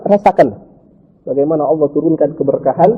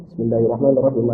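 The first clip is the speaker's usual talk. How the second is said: fast, low, soft recitation; bacaan hadar cepat; 5 semitones lower, 8 dB quieter than usual. Shown as a demonstration. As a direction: recite the basmala quickly, low and softly. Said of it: "Kalau hadar itu cepat". (bacaan hadar, cepat dan pelan suaranya)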